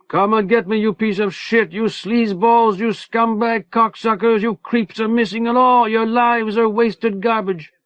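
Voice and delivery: Manny jeering at the inmates; yelling insults. A narrator's reading. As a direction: hurl insults over the wall. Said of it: "Manny was yelling".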